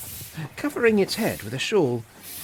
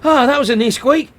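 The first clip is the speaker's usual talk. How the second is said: panting; 8 semitones higher, 7 dB louder than usual. Acted.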